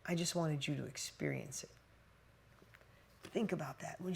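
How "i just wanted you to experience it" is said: soft sincere quote. Retold or imitated. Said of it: "She said".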